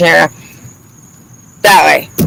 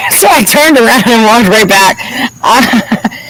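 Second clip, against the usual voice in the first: higher-pitched, amused through laughter; cracking up at the absurdity; laughing while talking; 4 semitones higher, slower than usual. Real, not acted.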